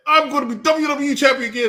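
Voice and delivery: high, loud wrestler proclamation; boastful mock shout. Wrestler announcing his comeback, mock boastful shout. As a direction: crow it high like a hammed-up wrestler. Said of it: "He said".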